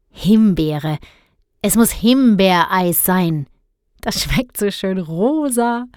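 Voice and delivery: betont schwärmendes Kind, das Himbeereis bestellt; kichernder Guntram. A narrator's reading. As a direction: begeistert bestellen, Schlüsselwörter laut herausheben. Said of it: "kicherte Guntram". (kichernd-begeistertes Kind)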